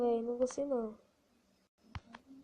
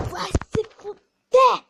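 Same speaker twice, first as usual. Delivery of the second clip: much higher, louder, slow cursing scream; furious yell; 9 semitones higher, 13 dB louder than usual. Real, not acted. Frustrated gamer screams a curse at the game. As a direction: spit the curse out at full volume.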